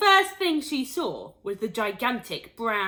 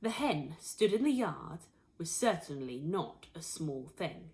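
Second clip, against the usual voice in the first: ordinary delivery, nothing marked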